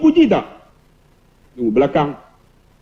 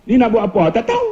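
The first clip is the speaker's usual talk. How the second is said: imitated Kedah question shooting up on "tahu"; Kedah dialect; 3 semitones higher than usual. Kedah patient at the clinic, mock Kedah dialect. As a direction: Kedah asker with a high, laughing tail. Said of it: "kalau orang Kedah pergi".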